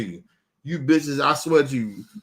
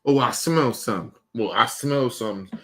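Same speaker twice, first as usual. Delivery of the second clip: lightly voiced cop sniffing around; imitating a cop claiming he smells something; mock cop voice; 6 semitones lower than usual. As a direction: mimic the officer's excuse, a little lower. Mocking a suspicious cop.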